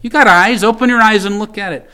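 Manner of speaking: urging prayer. Retold or imitated. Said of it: "and he says".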